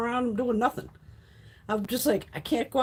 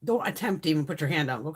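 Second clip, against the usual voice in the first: ordinary delivery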